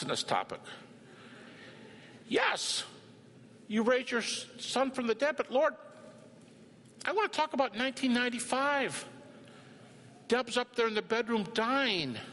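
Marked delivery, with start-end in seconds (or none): 2.3-12.2 pleading with God. Pretend. Pleading with the Lord.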